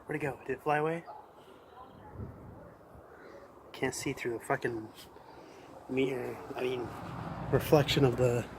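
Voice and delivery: hushed voice. Real, not acted. Searching for the bird quietly.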